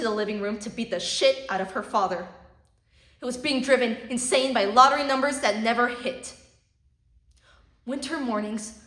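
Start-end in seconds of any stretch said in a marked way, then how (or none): 3.2-6.3 angry rant. Acted. Rising rant about the projects.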